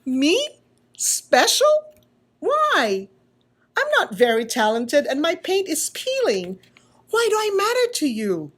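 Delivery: can't believe he matters; little wooden puppet, astonished; disbelieving puppet. Acted. Punchinello voice in disbelief.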